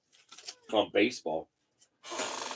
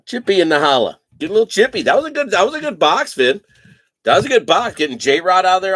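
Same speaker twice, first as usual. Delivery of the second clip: louder and higher, hyping the box; hyped celebration; 4 semitones higher, 10 dB louder than usual. Real, not acted. Host celebrates a good box with viewers, loud and hyped. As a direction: cheer the box like it paid off.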